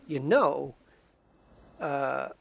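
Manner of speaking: halting actor impression; lightly mimicked drawl of a film star; Christopher Walken. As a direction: imitate a famous actor's odd cadence. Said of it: "He talks like".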